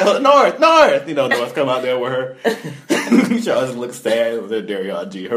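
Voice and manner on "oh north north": very high, loud, giddy voice; Kim Kardashian impression. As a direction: squeal it like a flustered socialite. Voicing Kim calling daughter.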